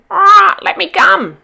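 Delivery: high, loud, slow squawking cry; a cockatoo pleading to come along. Acted. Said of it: "she'd cried".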